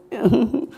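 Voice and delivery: a short, high giggle. Real, not acted. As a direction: giggle between the words. Laughing while telling the story.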